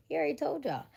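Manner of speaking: high-pitched mock scolding; told-you-so. Telling people God warned them, mock scolding. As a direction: remind them like they should have known.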